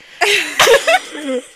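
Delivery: real burst of laughing, high and loud; loud laughter. Real, not acted. Storyteller reaches the punchline, real loud laugh.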